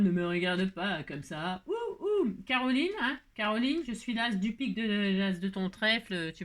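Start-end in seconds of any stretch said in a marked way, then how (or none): 0.0-1.6 low, slow mock warning to a card; slowly, a playful stern warning. Talking to the card figure. 1.7-2.3 a high playful ouh ouh; high mock hoot; high cooing hoots. Cooing at Carole.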